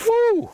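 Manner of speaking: sudden high woo at stinging fumes; high whoop. Real, not acted. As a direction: let out a sharp, high, loud woo. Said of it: "The fumes from that jalapeno just got me".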